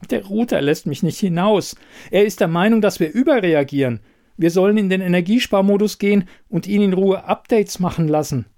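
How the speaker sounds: höher gestimmte Kühlschrankstimme, eifrig; Kühlschrank. A narrator's reading. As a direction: ein hilfsbereiter Kühlschrank mit einer Idee. Kühlschrank berichtet aufgeregt. (Kühlschrankfigur, höher und lebhaft)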